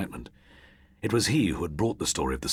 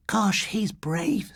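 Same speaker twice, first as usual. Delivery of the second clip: high, very slow admiration; girl watching boy climb in, awed; 3 dB louder, much slower than usual. A narrator's reading. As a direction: breathe it in awe. Dawn admires.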